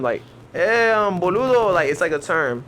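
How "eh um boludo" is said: mock Argentinian accent, pitched up. Argentinian man calling his friend, imitated Argentinian accent. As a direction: greet him like an Argentinian, voice raised in pitch. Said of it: "tell is Argentinian by his accent".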